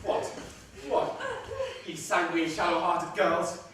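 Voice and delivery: Aaron mocking the pale brothers; scornful tirade. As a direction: lash them with contempt.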